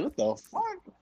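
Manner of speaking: high squeaky disbelief; shocked squeal. Retold or imitated. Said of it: "I was like".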